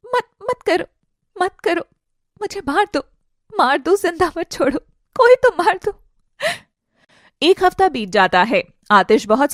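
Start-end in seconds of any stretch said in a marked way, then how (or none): 0.1-6.0 high, halting, anguished begging; desperate pleading. Acted. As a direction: beg brokenly in despair. Aarohi begs to be killed.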